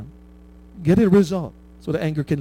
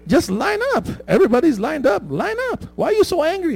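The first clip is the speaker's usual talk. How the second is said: mock scolding; 10 semitones higher than usual. Mock scolding the angry customer.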